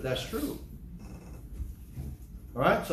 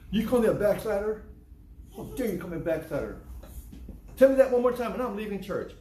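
mock outrage; 4 semitones higher than usual. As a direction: play the insulted member storming out. Offended churchgoer acted, rising indignation.

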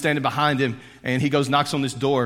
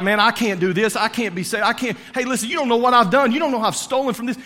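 a sinner pouring out his guilt to Jesus, raised and pleading; pleading Zacchaeus; 7 semitones higher than usual. Acted. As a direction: pour it out like a desperate sinner. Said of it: "He begins to pour out".

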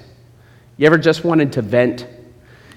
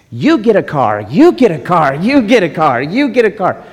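Oprah giveaway; 3 semitones higher, 3 dB louder than usual. Retold or imitated. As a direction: hype each car giveaway bigger. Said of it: "she sat on a couch and said".

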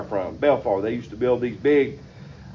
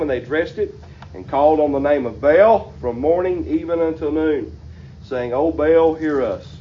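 raised, fervent preaching read of the verse; scripture reading; 4 semitones higher than usual. Acted. Preacher reads the verse to his congregation, fervent. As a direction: proclaim the Bible text to the congregation.

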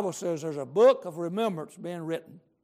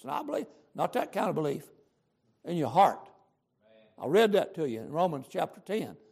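ordinary delivery

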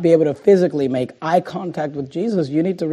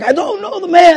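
high-pitched, mildly raised denial; half-voiced yell, pitched way up; mock yell; 13 semitones higher than usual. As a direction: imitate a heated protest without full volume. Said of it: "he's cursing and he's yelling".

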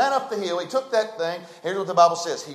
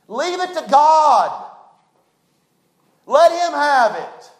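forceful plea; 9 semitones higher, 8 dB louder, slower than usual. Acted. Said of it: "preacher urging at full force".

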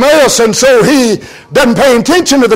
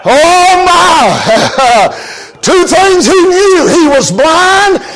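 fiery preaching; 4 semitones higher than usual. Acted. Preacher rousing the congregation, full force. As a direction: preach it out to the whole church.

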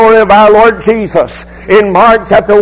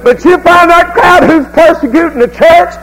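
fiery preaching; 5 semitones higher than usual. Acted. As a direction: preach it hard to the congregation. Preacher at full force.

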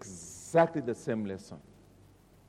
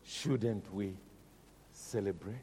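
usual speaking voice

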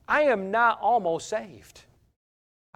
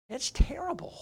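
hushed whisper; 5 semitones higher, 9 dB quieter than usual. Real, not acted. Preacher whispering to the congregation how terrible it is. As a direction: whisper it, almost breathless with dismay. Lament about the devil's discouragement.

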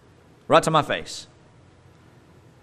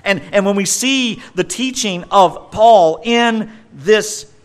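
higher, louder impassioned preaching; preaching at full force; 7 semitones higher, 5 dB louder than usual. Acted. Preacher building to a passionate point for the church. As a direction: lift the whole passage up with fervour.

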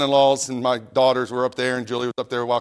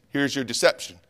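ordinary delivery

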